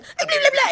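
put-on high-pitched toddler babble; baby babble. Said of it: "making weird noises".

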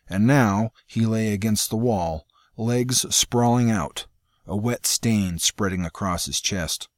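plain reading voice

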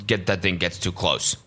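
normal talking voice

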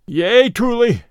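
high, loud agreement; aloud. A narrator's reading. Beaten fighter conceding to the tanner, aloud. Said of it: "the first aloud".

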